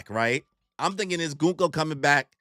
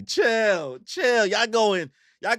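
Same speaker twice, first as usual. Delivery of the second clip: high, louder chill; 7 semitones higher, 5 dB louder, much slower than usual. Real, not acted. Reacting to chat comment.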